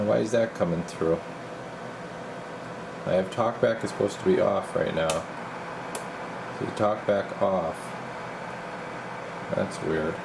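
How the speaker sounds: ordinary delivery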